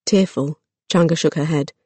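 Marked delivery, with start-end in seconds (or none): none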